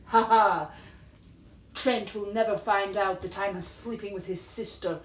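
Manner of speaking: hammed-up scheming soap villain, gloating laugh first; mock-melodramatic evil gloat, said out loud; soap villainess. Soap schemer in the victim's house gloating aloud, mock villainess. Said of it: "she'd stand at a corner, like, in the person's house and be like".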